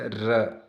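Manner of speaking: "र" with the tongue rolling on the palate; rolled r. Shown as a demonstration. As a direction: trill the start of "र". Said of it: "जीभ तालु से लुढ़क कर स्पर्श करे". ("र" को जीभ लुढ़काकर बोलें)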